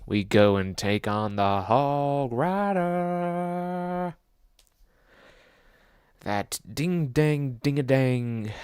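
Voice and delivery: slow, drawn-out announcement; dramatic. Acted. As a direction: build it up like a trailer. Hyping the boss fight.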